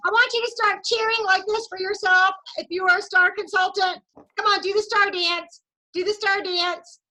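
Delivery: rally everyone into the star dance; coaxing the group to cheer; excited cheering. Real, not acted.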